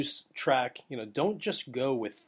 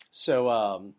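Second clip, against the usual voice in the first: normal talking voice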